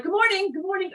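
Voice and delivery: high, sing-song good morning; cheery greeting. Retold or imitated. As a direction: greet every passerby brightly. Greeting strangers in New York.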